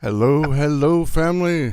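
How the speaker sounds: bright, loud calling-out hello, rising in pitch; hearty livestream welcome; loud greeting. Real, not acted. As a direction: call out to the whole family as they arrive. Streamer greets her live audience.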